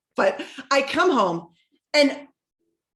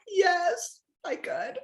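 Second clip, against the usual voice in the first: high yes, then a small soft finish; retold tearful assent; 8 semitones higher, 3 dB quieter, much slower than usual. Crying client agreeing to eat as told, mock-tearful giving in. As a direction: give in with a mock whimper.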